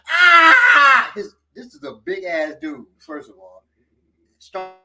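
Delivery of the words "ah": loud, high "aah"; long hammed-up yell; mock pain scream. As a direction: imitate a big man yelling in pain. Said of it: "he was like".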